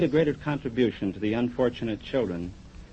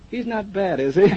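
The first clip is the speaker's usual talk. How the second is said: higher-pitched quote breaking into a laugh; amused retold quote; 8 semitones higher, 6 dB louder than usual. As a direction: imitate the kid, laughing at the punchline.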